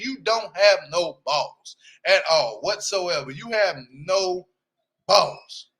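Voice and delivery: slow, with a pause before balls; emphatic, slow. Real, not acted. Telling the dad off to camera, slow and deliberate. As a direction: drag it out, then drop balls.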